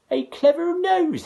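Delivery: high, loud scornful mother; mother mocking Jack; mother hissing. A narrator's reading. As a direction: hiss it in disbelief. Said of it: "his mother hissed".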